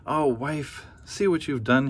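husband crying out at his wife with sausages on his nose; husband cries out. Acted. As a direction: throw the blame back at her. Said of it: "he cried".

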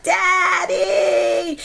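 imitating a scared little girl's high shout; child calling for daddy. Girl spotting a spider shouts for her father, mock-scared and high. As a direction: yell for dad like a frightened child. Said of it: "I was like".

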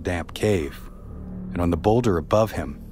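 usual speaking voice

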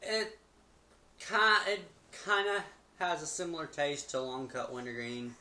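talking with tobacco in lip; lip full of dip. Real, not acted. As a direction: mouth full of fine cut dip. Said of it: "Gets all in your mouth when you're talking".

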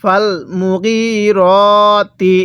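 মুগিরতি drawn out on long "ee" and "o" vowels; slow Quran recitation. Shown as a demonstration. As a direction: chant it very slowly, holding each long vowel. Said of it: "তাহলে কিভাবে পড়ব?".

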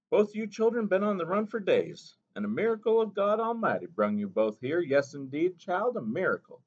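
put-on a gentle old mother reminding the boy; Mo Mo Bay. A narrator's reading.

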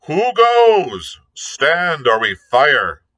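sharp high hail, loud at the end; hailing challenge. A narrator's reading. The captain hails the buccaneer, loud and high. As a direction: call out a challenge to the stranger. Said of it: "he hailed the buccaneer".

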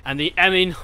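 ordinary talk

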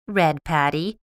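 ordinary delivery

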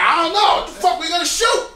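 thug outburst. Retold or imitated. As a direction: yell it like a thug. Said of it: "I'm like".

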